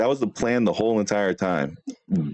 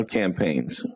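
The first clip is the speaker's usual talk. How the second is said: ordinary talk, nothing marked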